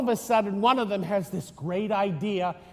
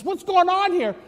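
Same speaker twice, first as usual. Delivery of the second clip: higher, pointed question; mock Herod demanding; 8 semitones higher than usual. King Herod questioning the magi, mock alarm.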